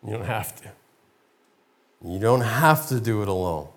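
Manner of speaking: calm him like a quiet inner voice; gentle voice. Acted. The quiet voice to Moses, softly reassuring. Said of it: "There's a voice that says".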